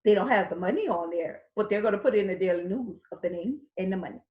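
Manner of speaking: usual speaking voice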